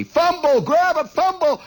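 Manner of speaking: loud, high urgent holler. Retold or imitated. Teammate hollers about the loose ball, hollering. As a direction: holler it across the field, pitch way up. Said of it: "somebody hollered back at me".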